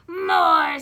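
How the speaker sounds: loud stern order; drill-sergeant coach shout; barked command. Acted. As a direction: bark it at the team. Coach Swamp barking orders.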